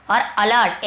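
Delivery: ordinary talk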